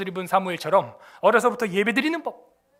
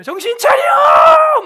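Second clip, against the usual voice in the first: much higher, louder, slow drawn-out shout; mock coach yell; 12 dB louder, much slower than usual. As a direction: roar it across the pitch. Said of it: "이 감독이 어떻게 하는지 제가 보여줬어요".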